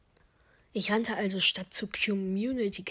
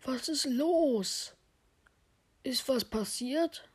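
higher-pitched, drawn-out character question; questioning crewmate; 5 semitones higher, slower than usual. Acted. Crewmate asks what happened, questioning voice. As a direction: ask it as another crew member, pitched up. Orange fragt, was passiert ist.

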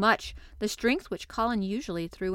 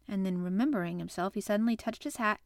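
ordinary delivery, nothing marked

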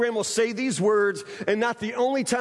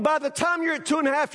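ordinary delivery

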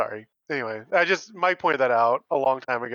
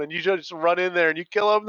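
normal talking voice

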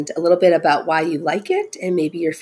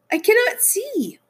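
very high, panicky character voice; distressed cry. Acted. Toad with ice cream over his face, distressed cry. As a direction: wail it far above your usual pitch. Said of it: "cried Toad".

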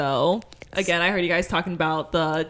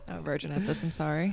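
too quiet; 11 dB quieter, faster than usual. Real, not acted. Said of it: "No, loud".

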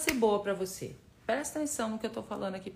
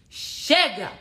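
battle cry shout; 4 semitones higher, 7 dB louder than usual. Shown as a demonstration. Coach urging viewers to say enough, a loud battle cry. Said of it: "Dá um grito de guerra aí".

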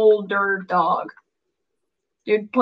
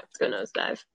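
whisper; 8 dB quieter than usual. Real, not acted. Unvoiced whisper.

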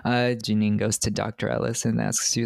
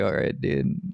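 much lower, bored dude voice; deep mock voice; 9 semitones lower than usual. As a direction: drop way down for a bored brush-off. Mimicking Cecily's bored reply.

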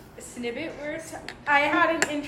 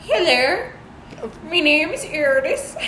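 slightly raised attempt at a Danish accent; mock Danish accent; 4 semitones higher, 3 dB louder than usual. Second try at the Danish girl's introduction, milder mock accent. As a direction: imitate a Danish girl, putting on her accent. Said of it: "she was like".

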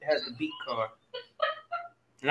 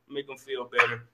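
ordinary talk

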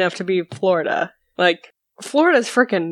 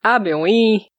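put-on Quebec accent. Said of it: "The Quebec accent is, like, so weird".

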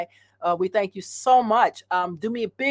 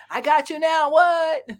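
playful gotcha; 8 semitones higher, 4 dB louder, slower than usual. Retold or imitated. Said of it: "laughs, retelling cashier".

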